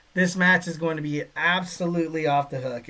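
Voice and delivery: ordinary talk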